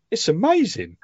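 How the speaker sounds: mock teary amazement. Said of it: "sent me a voice note and went".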